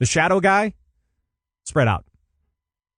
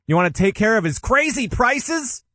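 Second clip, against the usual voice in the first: high, giddy clown voice; silly clown; 8 semitones higher than usual. Acted. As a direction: ham it up like a clown. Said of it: "I was going for any kind of clown voice".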